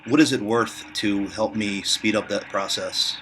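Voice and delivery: ordinary talk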